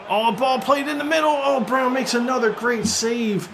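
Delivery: high, quick burst of excitement; fast excited call. Real, not acted. Commentator reacting to another save, fast excited call. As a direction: rush the call as the save happens. Ball in, keeper saves again.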